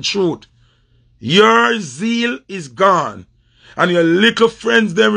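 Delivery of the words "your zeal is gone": very slow, each word weighted; slow scornful pronouncement to a rival; slow verdict. Real, not acted. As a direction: hand down the verdict slowly. Repeats the verdict slowly.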